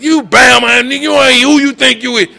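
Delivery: high, loud mocking jab; scornful taunt. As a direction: jeer it like a doubter on the block. Quoting taunting critics.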